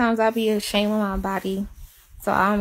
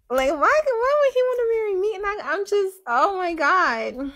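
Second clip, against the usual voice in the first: overwhelmed disbelief; 10 semitones higher than usual. Retold or imitated.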